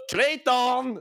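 high, loud questioning call of the name; wondering aloud whether to yell the name; mock shout. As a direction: shout the name across the room, unsure. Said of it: "ska jag skrika".

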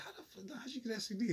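mock a stunned high voice; high squeaky quote. Retelling the comic's bit, in a high squeaky voice. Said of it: "And he's like".

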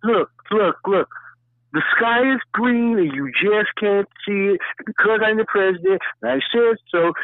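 hammed-up imitation of a pushy liar; bossy know-it-all. Mocking a guy who lies to your face, high pushy voice. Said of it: "come to your face and be like".

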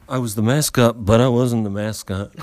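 host naming the story title; title announcement. Acted. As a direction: read it like a show title. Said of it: "That story was called".

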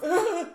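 high, loud mock gasps; startled waking cry. Film heroine waking from a dream, startled cries. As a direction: imitate jolting awake from a dream. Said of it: "she woke up after a dream and went".

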